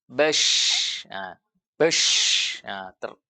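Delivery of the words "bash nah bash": "bash" with a long hissing sh; napas mengalir keluar. Shown as a demonstration. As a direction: let the air stream out at the end of "bash". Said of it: "mengalir keluar". ("bash" dengan desis sh panjang, napas keluar)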